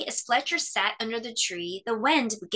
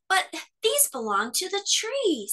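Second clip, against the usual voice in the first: little bear; 9 semitones higher than usual. A narrator's reading. Cub protesting.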